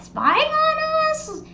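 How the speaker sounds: high, drawn-out squeal of disbelief; mock suspicion. Storyteller wondering about the neighbors' motives, mock suspicious squeal. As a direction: stretch it way up high, incredulous. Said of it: "I was just like".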